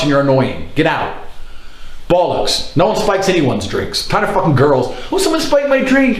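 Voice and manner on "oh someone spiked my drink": high, sing-song mock girl; mocking girl's excuse. Mocking drunk girls' excuse.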